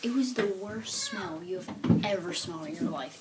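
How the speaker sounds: confiding whisper. Retold or imitated. Teller confiding to viewers about the fart, whispered. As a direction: whisper it like a secret.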